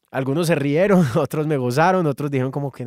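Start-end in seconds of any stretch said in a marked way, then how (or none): none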